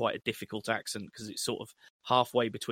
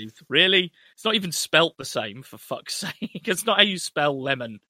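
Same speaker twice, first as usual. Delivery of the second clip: louder, higher mock exasperation; exasperated; 4 semitones higher, 7 dB louder than usual. Film fan mock-complaining to the studio, exasperated. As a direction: protest in loud, pained disbelief.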